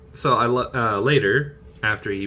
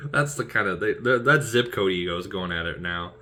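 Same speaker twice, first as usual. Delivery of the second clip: usual speaking voice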